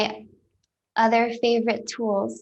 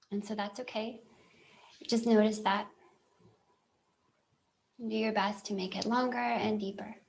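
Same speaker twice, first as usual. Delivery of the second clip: guided meditation. Real, not acted. Telling listeners it's okay to just notice, calm guided meditation. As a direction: talk them gently through noticing, with long silences.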